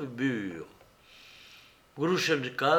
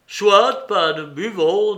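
loud, raised, measured acceptance; important but courteous; 6 semitones higher, 9 dB louder, slower than usual. A narrator's reading. Pan with the pipe accepts a drink, self-important but courteous. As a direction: grant it with stately politeness. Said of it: "d'un air important, mais courtois".

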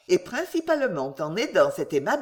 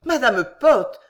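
higher, louder polite appeal; a guest calling a hysterical lady to reason; gentleman intervening; 5 semitones higher, 7 dB louder than usual. A narrator's reading. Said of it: "reprit monsieur Winkle". (interpellation polie et pressante, plus aiguë)

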